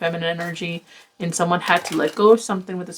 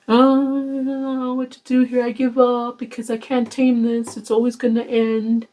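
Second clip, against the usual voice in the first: mock helpless lament; 5 semitones higher than usual. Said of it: "somebody here that cheated on you".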